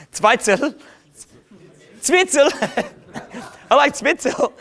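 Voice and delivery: very high, giggly German accent; comic German accent. Shown as a demonstration. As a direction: squeal it in mock German, laughing. Comic German word, laughing.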